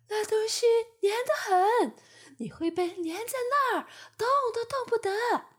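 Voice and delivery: high, loud fly voice warning. Acted. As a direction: caution a greedy friend. (提醒的苍蝇)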